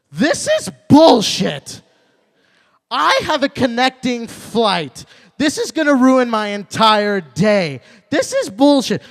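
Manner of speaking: high, loud, slow outraged complaint; angry passenger rant. Retold or imitated. Passenger next to him ranting about the landing, loud and high. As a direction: rage at the landing like a wronged customer. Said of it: "she goes".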